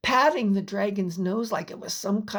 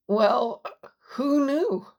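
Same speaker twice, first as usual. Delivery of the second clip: stammering amazement; 3 semitones higher, 3 dB louder, slower than usual. Acted. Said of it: "Jules managed to stammer".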